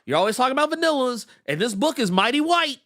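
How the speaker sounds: long, high "whoa" easing into protest; imagined objecting listeners. Retold or imitated. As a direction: imitate listeners halting the host to object. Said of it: "there's gonna be some people who be like".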